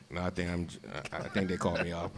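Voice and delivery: low, soft, halting admission; sheepish evasion. Retold or imitated. Player retells dodging teammates on the bus, sheepishly quiet. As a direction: play it down quietly, stumbling into the confession.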